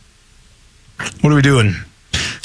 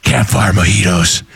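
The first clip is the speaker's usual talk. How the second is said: Batman voice; 10 semitones lower than usual. Acted. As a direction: read the camp ad like a dark-knight growl. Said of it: "But that's his Batman voice".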